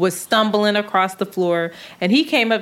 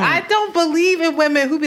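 higher, insistent rant; heated opinion; 7 semitones higher than usual. Real, not acted. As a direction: push back hard on the idea.

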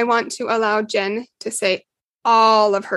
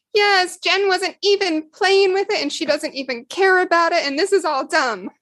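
put-on a sulky kid brushing it all off; child's protest; 8 semitones higher than usual. Betty voiced as small child.

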